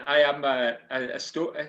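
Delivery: ordinary delivery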